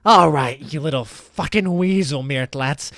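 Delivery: loud, scornful confrontation; full of contempt. Acted. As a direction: lean hard on the words with open disdain. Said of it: "person just full of contempt".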